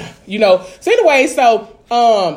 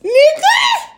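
building to a high-pitched shouted last word; hammed-up outrage, rising to a squeal; indignant retort; 6 semitones higher, slower than usual. Clapping back at a man's compliment, indignant outcry.

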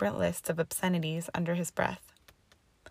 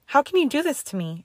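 a higher, louder moaning protest; 8 semitones higher, 5 dB louder than usual. A narrator's reading. Hermione moans at the headmistress in despair, higher and louder. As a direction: moan it out in despair, pitch up. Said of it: "she moaned".

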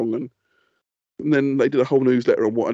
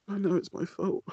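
ordinary talk

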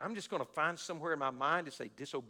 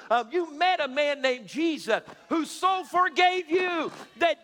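high, loud, slow preacher's cry; full-force preaching; 11 semitones higher, 12 dB louder, slower than usual. Acted.

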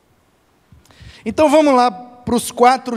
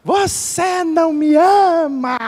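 mock cry of complaint; 8 semitones higher, slower than usual. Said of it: "Aí ela se frustra e grita".

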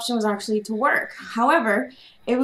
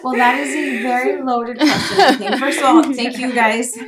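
laughing; 4 semitones higher, 5 dB louder than usual. Real, not acted. Guest laughs answering loaded question.

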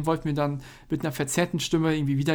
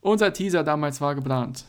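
normal talking voice

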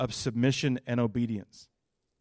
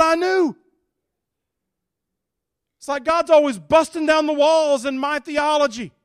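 much higher, loud preacher's fervor; preaching at full force; 11 semitones higher, 8 dB louder than usual. Acted. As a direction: drive it home to the church.

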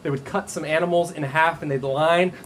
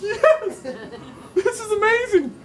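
much higher, louder, laughing cheer; overjoyed; 4 dB louder, slower than usual. Pretend. Worker paid a hundred for one hour, laughing cheer. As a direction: burst out with delighted laughter.

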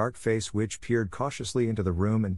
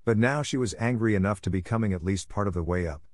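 ordinary delivery, nothing marked

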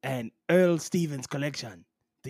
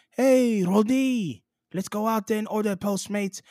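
put-on high, loud French-accented hail; calling out; 7 semitones higher, 4 dB louder than usual. Teammate hailing Rudy to go eat, calling out. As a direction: call out brightly in a French accent.

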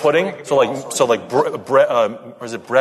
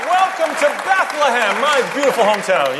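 grand welcome; 7 semitones higher than usual. Real, not acted. Guest welcomes everyone to his hometown, grand and high. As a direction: proclaim it proudly to a crowd. Host city's guest greets the crowd.

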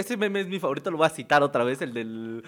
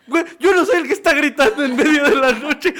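laughing retort; 9 semitones higher, 8 dB louder than usual. Retold or imitated.

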